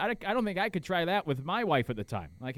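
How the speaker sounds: ordinary delivery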